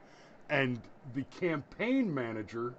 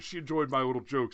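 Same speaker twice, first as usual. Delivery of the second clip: usual speaking voice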